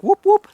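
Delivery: high, loud imitation of a strange call; imitated whoop. Ghost hunter imitating the eerie sound heard in the woods. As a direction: echo back the weird whoop sound you heard. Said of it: "And then I heard, like".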